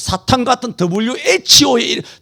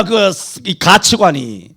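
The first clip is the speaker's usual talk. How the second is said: ordinary delivery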